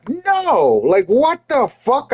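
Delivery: high-pitched, drawn-up indignant retort; incredulous outrage. Retold or imitated. Speaker refusing the family friend's deal, incredulous outrage. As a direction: retell it in high-pitched disbelief.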